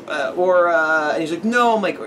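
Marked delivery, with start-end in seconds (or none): 1.4-1.7 put-on a high little boy's no; kid voice. Kid answers the narrator, in a high voice. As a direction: say it like a small kid.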